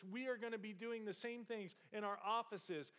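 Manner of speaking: usual speaking voice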